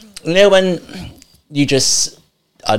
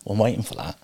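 whisper; 11 dB quieter than usual. Real, not acted. Mock suspense about affirmation.

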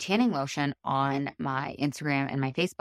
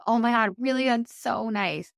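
delighted surprise; 7 semitones higher, 4 dB louder than usual. Retold or imitated. Voicing friend's delighted reply to invite.

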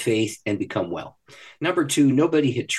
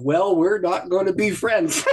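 slow, higher, laughing aside; laughing resignation; 7 semitones higher, 3 dB louder, slower than usual. Real, not acted. Ruefully laughing about the mocking classmate. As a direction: chuckle through it, slowly.

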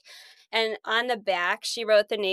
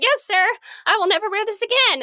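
high, loud mock-obedient reply; acted obedience; 11 semitones higher, 5 dB louder, faster than usual. Student answering the headmaster in his office, eagerly obedient. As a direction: promise it hastily, pitched way up.

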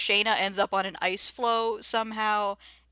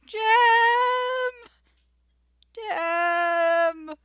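high, long-drawn call into the distance; loud wilderness call, held long; imitated searching shout; 10 semitones higher, 11 dB louder, much slower than usual. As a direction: holler the name, stretching the vowel.

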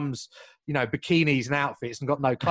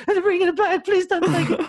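cracking, much higher pleading voice with a laugh; voice breaking; 5 dB louder than usual. Retold or imitated. To the security guard, begging with a cracking voice. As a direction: beg as if your voice is about to crack. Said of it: "voice is breaking".

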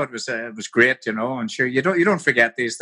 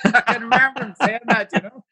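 laughing; 9 semitones higher than usual. Real, not acted. Laughing at the old man's remark.

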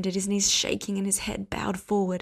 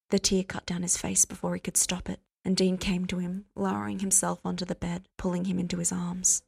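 plain reading voice

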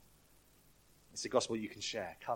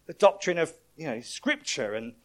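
higher, louder puzzled questioning; exasperated supervisor angel; 9 semitones higher, 10 dB louder than usual. Retold or imitated. Pastor voicing the angel quizzing the thief, higher and louder. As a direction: quiz him, growing baffled. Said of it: "And he goes".

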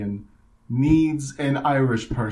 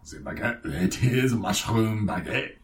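slow mock French; French accent. French mobster pitching his special bread, slow mock French. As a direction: stretch it out like a comic Frenchman. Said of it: "it's the French mafia".

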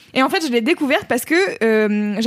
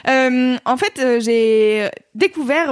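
usual speaking voice

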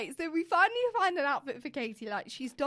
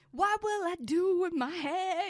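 dramatic movie quote; 5 semitones higher, slower than usual. Retold or imitated. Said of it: "that scene from High School Musical".

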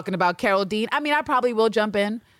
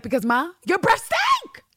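mock insult; 9 semitones higher than usual. Retelling Villanelle's insult.

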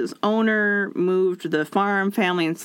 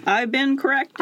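higher, sheepish admission; mock chastened; 6 semitones higher than usual. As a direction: concede meekly, pitched up. Voices chastened man backing down.

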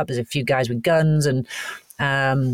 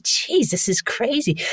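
stunned; 8 semitones higher than usual. Retold or imitated. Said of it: "I was like".

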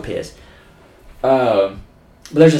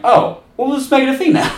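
mock studio exec; 13 semitones higher, 4 dB louder, faster than usual. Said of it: "And they're like".

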